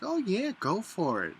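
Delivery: high-pitched mock agreement, dropping at the end; breezy encouragement. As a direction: imitate friends airily going along, starting high. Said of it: "and they're like".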